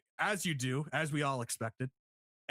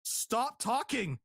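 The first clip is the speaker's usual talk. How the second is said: higher-pitched, drawn-out mock exasperation; retold exasperation; 5 semitones higher, much slower than usual. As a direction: act out begging a commentator to stop, slowly. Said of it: "I'm like".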